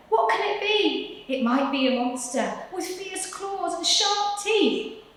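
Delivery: high, squeaky frightened voices; frightened mice. Acted. Mice imagine a monster, high and frightened. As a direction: worry aloud like scared little mice.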